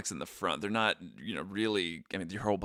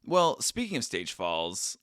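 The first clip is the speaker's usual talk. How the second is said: ordinary talk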